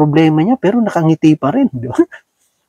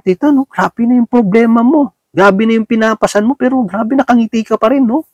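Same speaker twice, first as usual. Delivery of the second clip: lightly voiced friend, pitched higher, a touch of awe; a hint of an admiring neighbour; sympathetic friend; 6 semitones higher than usual. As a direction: marvel at her smile, pitched up. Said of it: "may magtatanong siya".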